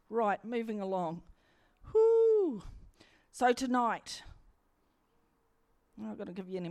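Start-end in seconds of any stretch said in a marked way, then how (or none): 1.9-2.6 high whoo. Real, not acted. Exhale before moving on.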